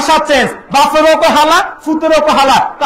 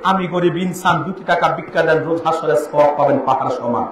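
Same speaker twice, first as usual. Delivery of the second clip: beggar's plea; 8 semitones lower, 5 dB quieter than usual. Retold or imitated. Beggar asking for alms.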